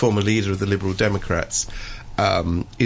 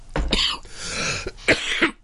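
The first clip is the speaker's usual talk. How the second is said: long spluttering cough; real cough, struggling to clear throat; coughing fit. Real, not acted. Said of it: "I'm really coughing and spluttering here".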